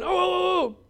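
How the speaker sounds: high, excited know-it-all voice; an overeager pupil blurting the answer; mock keen schoolboy; eager student. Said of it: "the eager student in the back of the room going".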